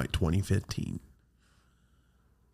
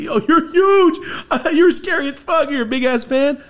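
stunned excitement; 9 dB louder than usual. Retold or imitated. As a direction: gush at the giant in disbelief. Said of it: "I'm like".

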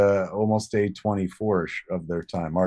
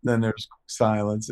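usual speaking voice

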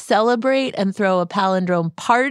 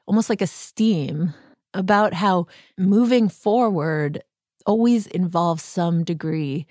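ordinary delivery

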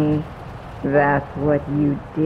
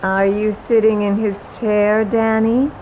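raised over the rain, quick and taunting; mildly raised, mocking ghost voice; louder ghost; 4 dB louder than usual. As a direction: call it out over the downpour. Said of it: "louder now to be heard over the downpour".

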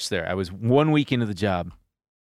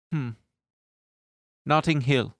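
a hint of a gentle German boss, pausing before he answers; lightly voiced sweet older boss, thoughtful hum first; the owner's voice; 3 semitones higher than usual. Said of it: "but he spoke like this".

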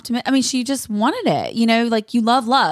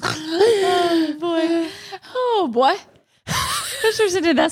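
laughing; 8 semitones higher, much slower than usual. Real, not acted. Laughing at own joke.

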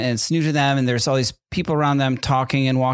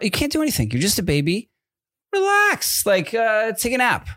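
put-on high-pitched exasperated appeal to a baby; comic exasperated telling-off; mock exasperation; 8 semitones higher than usual. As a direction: plead in a jumping, much higher pitch.

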